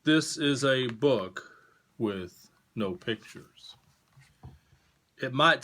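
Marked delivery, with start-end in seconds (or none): 0.0-3.8 slow, deliberate storybook read; reading to children. A narrator's reading. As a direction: read it to kids, slow and clear. Reading picture book aloud.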